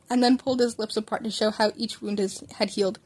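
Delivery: ordinary delivery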